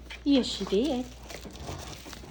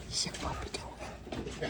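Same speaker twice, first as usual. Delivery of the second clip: whispered, much softer; 12 dB quieter, slower than usual. Real, not acted. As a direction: breathe it like a secret to the dog. Softly shows the toy.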